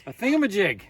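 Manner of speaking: loud comic blurt; playful shout. Real, not acted. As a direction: blurt it out proudly.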